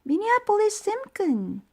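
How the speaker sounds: high, loud scolding call; yelling mother. A narrator's reading. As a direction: shout the full name sternly. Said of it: "yelled Mom".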